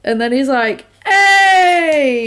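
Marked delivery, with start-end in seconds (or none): 1.4-2.3 loud, high mock-hearty "hey"; exuberant greeting. Film character hailing an old friend, exuberantly. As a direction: call out like spotting an old pal.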